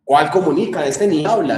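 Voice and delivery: normal talking voice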